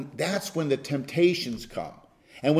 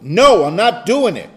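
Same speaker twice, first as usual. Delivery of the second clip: a firm, louder no that means it; firm spoken no; 3 semitones higher, 9 dB louder than usual. Shown as a demonstration. Pastor demonstrating the right way to refuse, firm loud no. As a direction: say it out loud with conviction. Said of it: "Talk out loud to it".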